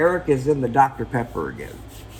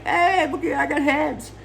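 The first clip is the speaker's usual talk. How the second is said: much higher, silly proud voice; goofy hero voice; 13 semitones higher than usual. Acted. Eric walks out on stage, boasts in a high goofy voice. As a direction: show off the heads like a cheerful kid.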